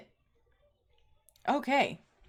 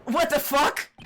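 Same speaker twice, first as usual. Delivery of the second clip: ordinary talk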